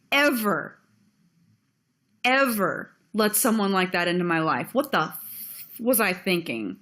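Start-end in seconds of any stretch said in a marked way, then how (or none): none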